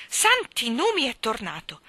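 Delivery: high, loud exclamation of amazement; astonished cry. A narrator's reading. Mayor seeing the lost statue back, astonished cry. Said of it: "egli gridò".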